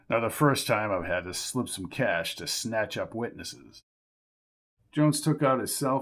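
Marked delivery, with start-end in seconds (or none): 0.1-3.8 put-on smug old man. Jerry brags.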